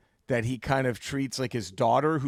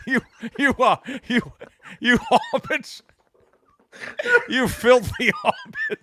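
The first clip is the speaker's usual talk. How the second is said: high, laughing mock Scottish accent; Sean Connery; 7 semitones higher than usual. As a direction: stammer a creature's insult in Scottish while cracking up. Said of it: "he can never just not be Sean Connery".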